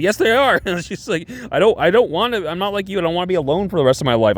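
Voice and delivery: high-pitched mock indignation; acted-out, high emotional retort; upset protest. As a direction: protest it, imitating an upset friend. Acted quarrel, Enid protests.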